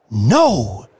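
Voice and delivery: loud triumphant cry. Acted. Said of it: "she cried triumphantly".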